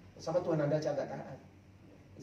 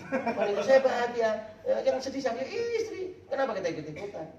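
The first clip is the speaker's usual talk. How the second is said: normal talking voice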